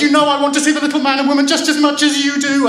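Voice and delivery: put-on fast, high, loud childish wail; crying out. A narrator's reading. Child protesting to sister, quarrelling and crying out. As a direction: rattle out a shrieky protest. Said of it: "she cried".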